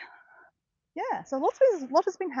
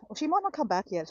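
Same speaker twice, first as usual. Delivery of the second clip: ordinary talk, nothing marked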